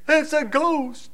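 high, drawn-out scared gasp of a line; mock frightened cry. Disciples in the boat seeing Jesus on the sea, mock frightened cry. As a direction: play the terrified disciples seeing a ghost. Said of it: "cried out for fear".

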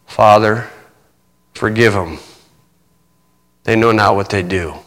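soft, low prayer from a dying man; quiet. Acted. Jesus on the cross forgiving his killers, hushed and low. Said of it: "he heard Jesus Christ quietly say".

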